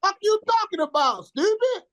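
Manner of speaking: high, heated retold clapback; indignant retort. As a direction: fire it back, insulted. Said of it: "I said".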